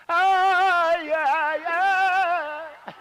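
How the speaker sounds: high, loud yelling yeah; loud high playful shout. Real, not acted. Loud outburst at the child.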